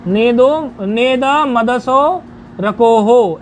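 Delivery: "नेद मदसो रकोहो" slow, syllables joined across words; छंदवत उच्चारण. Shown as a demonstration. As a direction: model "नेद मदसो रकोहो" as metrical verse with long vowels. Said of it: "ऐसे इसका उच्चारण है". (छंद की लय में, धीरे खींचकर)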